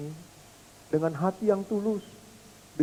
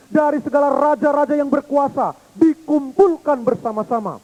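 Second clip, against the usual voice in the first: loud, high, building oratory; preaching at full force; 6 semitones higher, 6 dB louder than usual. Acted. Preacher rousing the congregation about Christ, loud and high. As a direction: proclaim it to the whole congregation.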